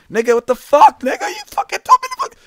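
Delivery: put-on high, loud child's outraged yell; shrieking up in disbelief at the teacher; indignant yell. Boy yelling at his teacher after wetting himself, high outraged yell.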